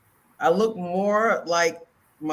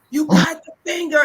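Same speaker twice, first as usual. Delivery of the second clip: retold excited discovery; 11 semitones higher, 6 dB louder than usual. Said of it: "I was like".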